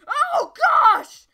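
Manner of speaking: loud high yelp of surprise; startled shout. Real, not acted. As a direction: blurt it out in shock. Startled falling into darkness.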